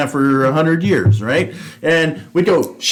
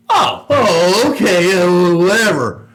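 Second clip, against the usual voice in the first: mock dismissive reply; 3 semitones higher, slower than usual. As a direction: play someone waving off a silly threat. Mimics lieutenant dismissing the warning.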